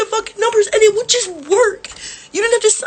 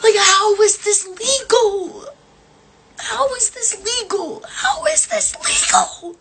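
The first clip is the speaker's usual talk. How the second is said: outraged disbelief; 3 semitones higher, slower than usual. Real, not acted. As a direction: voice climbing in real, exasperated disbelief. Outraged at the printer lockout.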